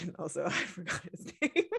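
ordinary talk

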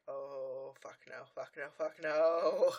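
panicked denial under the breath; horrified refusal. Retold or imitated. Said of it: "And Rarity is just like".

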